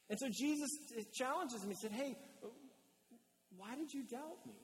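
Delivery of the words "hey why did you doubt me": soft, higher-pitched tender question; hushed, mildly wounded appeal; gentle challenge. Acted. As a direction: ask lovingly, without blame. Jesus gently challenges Peter.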